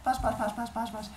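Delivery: high, repetitive fly noise; buzzing fly. Acted. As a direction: buzz like a pestering fly. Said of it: "he starts buzzing around her head".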